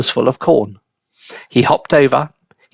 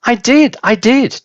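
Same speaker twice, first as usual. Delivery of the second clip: put-on high, louder insistent boy; boy insisting to his grandma; shouting; 10 semitones higher, 4 dB louder than usual. A narrator's reading. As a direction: shout it up to the roof. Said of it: "George shouted".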